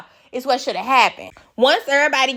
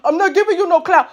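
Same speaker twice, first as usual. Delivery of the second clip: higher, mocking put-on voice; imitating Biggie; 6 semitones higher, 4 dB louder than usual. Host mimicking Biggie's no-clout speech, mocking. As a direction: parrot her speech with scorn. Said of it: "give us speeches about she not".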